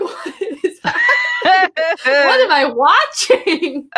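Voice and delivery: slow, laughing, half-shouted disbelief. Retold or imitated. Watching the couple make out, slow laughing disbelief. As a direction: laugh it out in amused shock. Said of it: "I was like".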